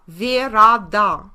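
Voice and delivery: "verada" said slowly in three syllables, ve-ra-da; slow old form. Shown as a demonstration. Teacher showing students the original sound of verdad. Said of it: "initial meaning of the Spanish verdad".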